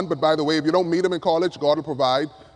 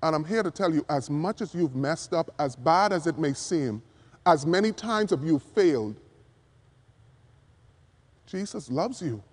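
softer and softer until Jesus loves you is near a whisper; reassuring hush; 3 semitones lower, 6 dB quieter than usual. Real, not acted. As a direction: lean in and comfort the one who feels worthless. Comforting a self-blaming listener.

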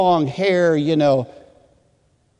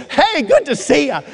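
hearty greeting; 11 semitones higher than usual. Retold or imitated. Congregation turning to greet a newcomer, hearty greeting. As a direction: greet him brightly, pitched well up.

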